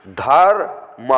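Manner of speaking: धर्म stretched slowly, dharm; slow demonstration. Shown as a demonstration. Teacher slowly models the word for students. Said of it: "teacher reading word".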